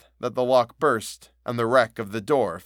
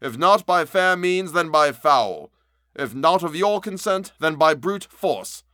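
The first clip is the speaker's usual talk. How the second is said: higher, louder and slowing on the ends; loud threat; 6 semitones higher, 5 dB louder than usual. A narrator's reading. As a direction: threaten him loudly, dragging out the endings. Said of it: "cried Utterson with a loud voice".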